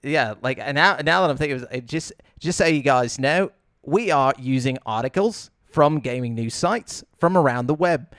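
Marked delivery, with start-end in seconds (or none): 2.4-8.1 mock Australian with phrase endings lifted; Australian upspeak. Shown as a demonstration. Host redoing the news disclaimer, demonstrating Australian upspeak. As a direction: redo it in rising Australian upspeak. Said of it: "make your voice go up".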